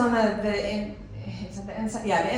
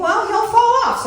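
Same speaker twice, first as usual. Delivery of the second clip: much higher, louder hopeful quip; hopeful thought; 13 semitones higher, 8 dB louder than usual. Retold or imitated. Driving off hoping the rat falls, high hopeful voice. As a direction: toss it off brightly, sure he'll fall.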